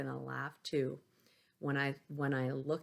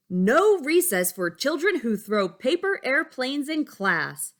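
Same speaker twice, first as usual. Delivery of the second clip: much higher, loud scolding shout; angry teacher shout; 9 semitones higher, 12 dB louder than usual. Acted. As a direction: scold the whole class at full volume. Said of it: "Miss Kirby roared".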